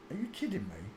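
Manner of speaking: ordinary talk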